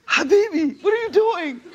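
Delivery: high-pitched, incredulous plea; hammed-up astonished scolding; mock disbelief. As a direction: exclaim in comic dismay, voice shooting up. Teller's shocked reply to the brothers.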